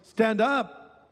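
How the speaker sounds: usual speaking voice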